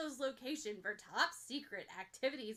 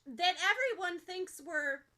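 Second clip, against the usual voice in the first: faltering; 7 semitones higher, 8 dB louder than usual. Acted. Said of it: "more bravely than she's able to finish".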